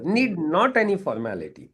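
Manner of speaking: emphatic rule. Shown as a demonstration. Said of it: "coach stresses a rule".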